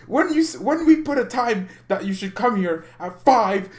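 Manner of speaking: high-pitched, worked-up complaint; exasperated rant. Real, not acted. Streamer complaining about guests arriving late, exasperated rant.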